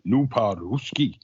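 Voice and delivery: bad imitation Russian accent with a Scottish actor's twist; drawn-out, badly done accent for a film submarine captain; Sean Connery. Film fan imitating a submarine captain's line, really bad accent. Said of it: "in a really bad accent".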